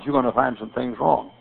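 ordinary talk, nothing marked

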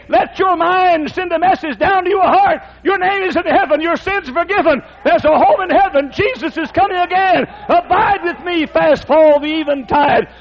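high, fervent sermon cry; full-force preaching. Acted. Preacher rousing his church, full-force preaching. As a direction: preach it up to a shout.

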